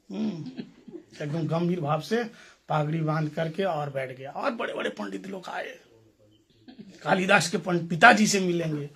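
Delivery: normal talking voice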